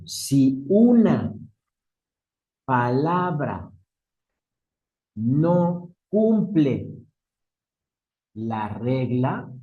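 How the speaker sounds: word by word with long pauses; measured, widely spaced phrases; slow dictation. Real, not acted. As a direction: dictate slowly for note-takers. Dictates definition of exception.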